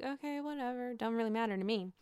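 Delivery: soft, shrugging okay whatever; dismissive mumble. Retold or imitated. Robin shrugging off the girls' gossip, quiet and dismissive. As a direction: brush it off quietly, not bothered. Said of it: "so she's like".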